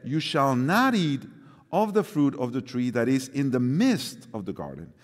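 ordinary talk

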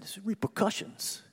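"repercussions" in a light northeastern US accent. Shown as a demonstration. Preacher showing congregation his favorite word, northeastern accent. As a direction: say "repercussions" like a New Englander. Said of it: "with a kind of northeastern accent".

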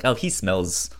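ordinary talk

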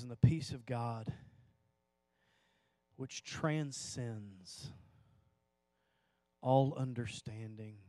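soft, very slow verse read; scripture read hushed and unhurried; solemn scripture reading. Acted. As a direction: let each phrase land quietly. Reads Philippians verse.

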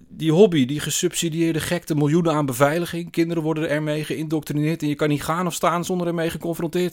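high, loud run of grievances; indignant tirade. A narrator's reading. Man listing grievances to narrator, indignant. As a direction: rattle off complaints in outrage.

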